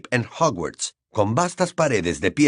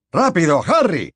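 higher, louder hurried call; urging; 6 semitones higher, 4 dB louder than usual. Acted. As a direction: press him to hurry. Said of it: "lo apremió".

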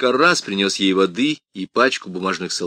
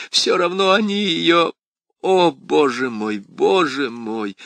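громкий высокий плач; причитай сквозь слёзы; wailing sob; 7 semitones higher, 5 dB louder than usual. A narrator's reading. Крис всхлипывает. (причитание)

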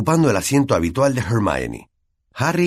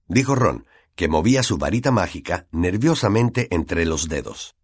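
usual speaking voice

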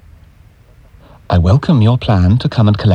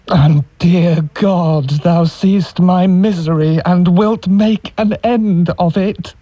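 despairing prayer; 6 semitones higher, slower than usual. Acted.

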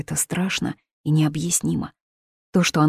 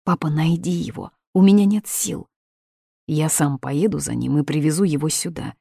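ordinary delivery, nothing marked

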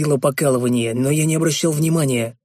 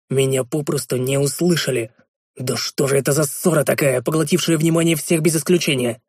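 usual speaking voice

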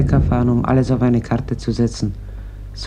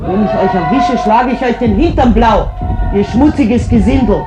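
angry yelling; 7 semitones higher, 5 dB louder than usual. Acted. Mother yelling out the window at the neighbour boys, furious. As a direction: shout it out, high and loud, furious.

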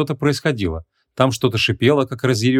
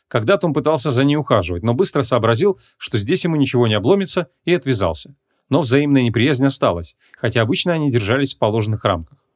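usual speaking voice

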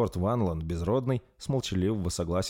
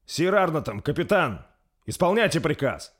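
higher, louder enraged prince; furious command; 10 semitones higher, 6 dB louder than usual. A narrator's reading. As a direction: order the guards in fury. Prince orders arrest. (принц в гневе приказывает страже)

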